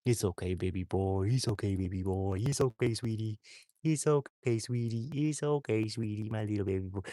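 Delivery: soft, hushed cooing, rising on each okay; calm the nervous dog gently; hushed comforting of a dog being groomed; soothing pet talk. Real, not acted. Soothing the wolfdog while plucking.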